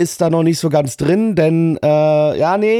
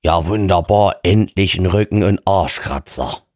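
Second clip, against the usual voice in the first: mock hero voice; 9 semitones lower than usual. Hero answers the sword, mock.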